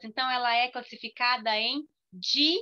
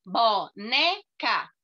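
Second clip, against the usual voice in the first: "Boneca" slowly split into bo, ne, ca; three punched, separate beats on "Boneca"; syllable by syllable; 4 dB louder, much slower than usual. Shown as a demonstration. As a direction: pause between every syllable of "Boneca". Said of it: "vendo quantas vezes você falou".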